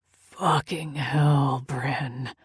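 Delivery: slow, low groaned curse with her name; groaning curse. Acted. Lover groaning at the sight of her, slow. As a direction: let the curse drag out as he groans. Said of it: "he groaned".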